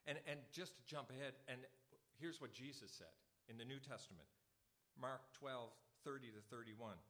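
usual speaking voice

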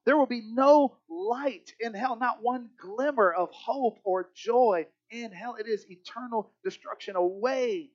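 rising, intense pulpit delivery; preaching at full force. Acted. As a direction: press each no in hell home. Preaching on hell, building.

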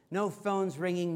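usual speaking voice

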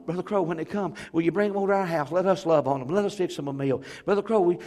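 high, loud and fast, tearful begging; bawling plea. Retold or imitated. Church members tearfully begging the pastor to let them help, loud and high. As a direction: cry out to be allowed to help.

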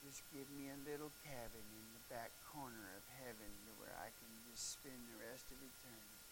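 gentle grandma. Retold or imitated. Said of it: "Grandma says".

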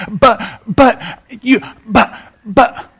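put-on a child cutting in with buts; stammering excuses. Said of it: "he says".

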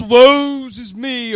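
slow, drawn-out mock country "woes is me"; imitating a country friend. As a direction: lightly put on his friend's country twang, slow. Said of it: "he's a little country and talks like this".

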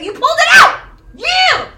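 put-on overjoyed parent shouting at a child; excited cheer. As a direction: celebrate the lost tooth at full volume. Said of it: "cheering on my children for".

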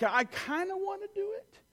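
put-on high, unsure small-boy voice; hesitant little boy. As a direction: play the nervous seven-year-old, half willing. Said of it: "You know, like".

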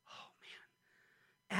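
breathy whispered oh man; hushed, awestruck aside; awed whisper. Real, not acted. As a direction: say it under your breath, amazed. Preacher marvels at the verse.

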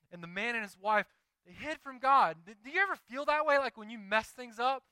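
loud, high preacher's call to the room; fiery revival preaching. Acted. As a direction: preach at full force, reaching the crowd. Preaching at full force.

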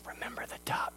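whispered menace in his ear; whispered threat. Retold or imitated. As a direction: hiss it as a secret blackmail threat. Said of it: "she leans over and goes".

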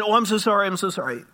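high, loud start easing off; flustered apology. Retold or imitated. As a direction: act out a doctor blurting an apology. Doctor hurriedly apologises for her word.

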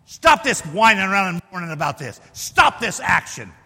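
firm, loud rebuke; bold, emphatic order; bold command. Acted. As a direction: put your foot down, hitting each STOP. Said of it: "you're gonna have to tell them".

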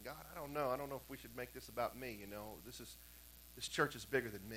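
low, hushed musing; quiet, reluctant private doubt; hesitant inner thought. Retold or imitated. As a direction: think it over softly, as if to yourself. Pastor's hesitant private thought, retold.

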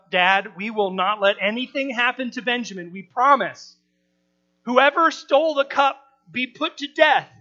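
loud retold vow, rising to a high solemn oath; earnest vow. Brothers' vow voiced in retelling.

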